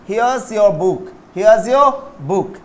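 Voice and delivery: here's your book spelled out slowly; teacher modelling slowly, word by word; slow model sentence. Shown as a demonstration. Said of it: "okay". (धीरे धीरे, here's your book)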